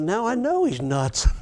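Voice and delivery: chuckling through the line; laughing. Real, not acted. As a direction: let a laugh break in. Laughs at getting a rock.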